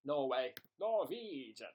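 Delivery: half-serious attempt at a Norwegian accent; mock Norwegian accent. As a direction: try out a hint of Norwegian. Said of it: "Do Norwegian".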